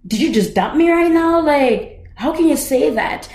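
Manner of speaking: high, indignant mock outrage at being dumped; outraged disbelief. Retelling being dumped, a high indignant disbelief. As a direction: squeal it like you can't believe he did that. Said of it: "I was like".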